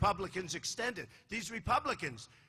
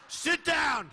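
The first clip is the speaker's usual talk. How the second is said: retold command; 7 semitones higher, 3 dB louder than usual. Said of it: "I said".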